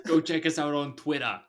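put-on Australian on "Twitter"; Australian-style Twitter. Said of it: "like the way you say Twitter".

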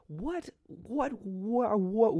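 high, cracking what what; mock shocked squeak. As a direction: squeal it in fake disbelief. Mock outrage at car with space.

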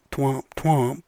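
sung-out twomp twomp; a deflated mock fanfare; little joke horn sound; mock sad trombone. Sign-off sound effect.

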